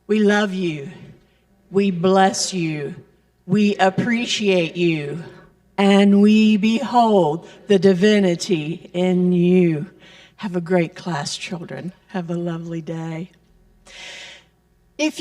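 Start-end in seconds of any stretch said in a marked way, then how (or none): 0.1-9.9 recited blessing. Real, not acted. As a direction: recite it together, deliberately, like a benediction. Blessing spoken over departing children.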